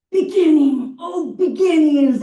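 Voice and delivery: high, loud, swept-away cry of Beginning; passionate artist. Retold or imitated. The artist, moved by his own sculpture, calls out passionately. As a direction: ham up the artist's passion, arms flying. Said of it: "And he talked like this".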